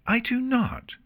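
high-pitched indignant protest; object, offended, pitched right up; indignant denial. Acted. Luna contradicting Artemis about coffee, indignant.

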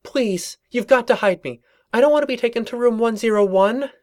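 high, pleading, panicked voice; gibbering panic. Acted. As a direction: beg to be hidden, terrified. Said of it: "She gibbered".

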